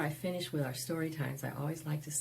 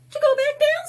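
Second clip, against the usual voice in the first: very high and loud quip; high child's voice; 13 dB louder, much faster than usual. Acted. Henry answering Pedro's question, very high and loud. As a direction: toss it back like a cheeky kid.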